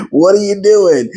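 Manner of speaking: high, puzzled movie quote; quoting the guy in the elevator scene; elevator guy from Liar Liar. Retold or imitated. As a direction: ask it like a baffled stranger. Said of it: "the guy walks in and goes".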